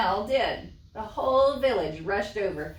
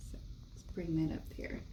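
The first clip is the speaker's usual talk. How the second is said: whisper; 2 semitones lower, 13 dB quieter, faster than usual. Real, not acted. Craft aside.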